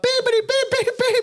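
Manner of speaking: very high string of bleeps; imitated censor beeps. Retelling a speaker swearing on stage, imitated censor bleeps.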